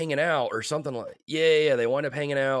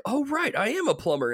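high-pitched dopey-hero realization, imitated; mock sudden realization; 12 semitones higher than usual. Luigi remembering he's a plumber, mock realization. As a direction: play the penny dropping, pitched way up. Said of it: "He's like".

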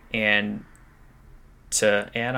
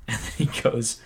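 ordinary talk

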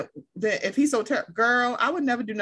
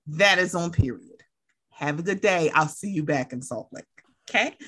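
low, controlled warning; stern warning; 6 semitones lower than usual. Acted. Warning a friend after Vegas, low and stern. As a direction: lay down the law in a low, measured voice.

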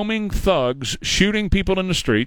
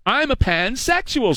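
mock teacher voice; 9 semitones higher, slower than usual. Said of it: "said the, the teacher".

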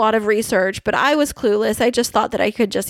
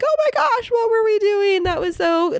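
mock disbelief; 11 semitones higher than usual. Cringing at old recording.

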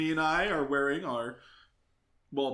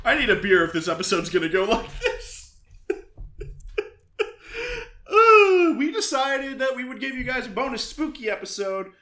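usual speaking voice

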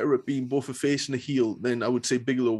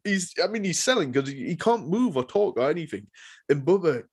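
ordinary talk

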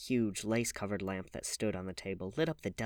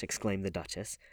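ordinary delivery

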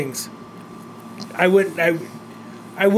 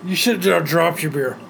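ordinary delivery

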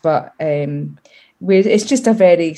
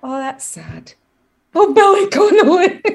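mock Queen; 11 semitones higher, 5 dB louder than usual. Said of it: "the Queen will go and will be like".

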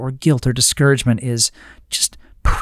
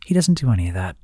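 normal talking voice

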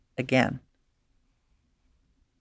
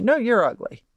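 "No" pitched much higher, then "you're ugly." falls away; childish comeback; 8 semitones higher than usual. Retold or imitated. Bullied girl echoes the insult back, higher mock retort. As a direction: bounce the insult back like a stubborn kid. Retold schoolyard insult exchange.

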